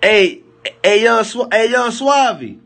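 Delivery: high, loud drawn-out hail; calling out. Real, not acted. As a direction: call across the room to Suave. Calling out to friend.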